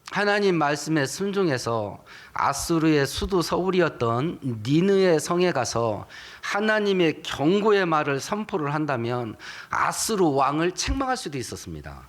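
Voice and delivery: normal talking voice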